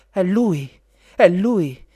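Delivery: loud, high, thrilled exclamation; emotional cry. A narrator's reading. Princess sees her prince at last, excited high cry. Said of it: "gridò emozionata".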